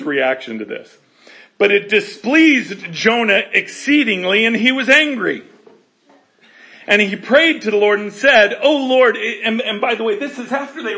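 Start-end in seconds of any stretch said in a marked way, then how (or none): 1.6-9.2 loud preaching read; forceful scripture reading. Acted.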